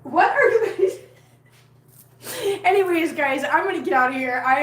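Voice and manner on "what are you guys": high, loud cry of disbelief; exasperated calling out. Real, not acted. Carer to noisy cats, exasperated calling out. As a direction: call out to the pets, half laughing.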